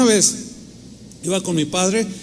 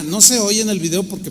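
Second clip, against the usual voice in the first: normal talking voice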